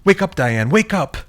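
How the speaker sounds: high, loud pleading yell; desperate shout at unconscious friend; frantic shout. Acted. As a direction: scream at her to wake up. Shaking unconscious friend awake.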